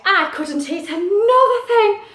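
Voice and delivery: loud, high, emphatic protest; acted, swooping exclamation; storybook cat character. A narrator's reading. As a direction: protest dramatically as the over-full cat. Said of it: "he said".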